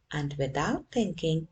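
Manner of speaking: ordinary delivery, nothing marked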